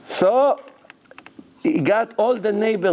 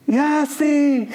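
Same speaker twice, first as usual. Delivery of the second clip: high, louder frantic call of the name; broker calling upset; 10 semitones higher, 3 dB louder than usual. Retold or imitated. Broker phoning the buyer, high frantic voice.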